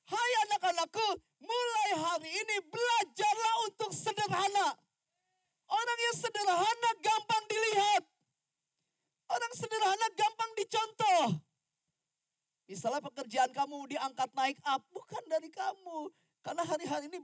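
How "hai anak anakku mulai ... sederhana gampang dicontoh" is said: God speaking. Retold or imitated. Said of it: "Tuhan bilang begini".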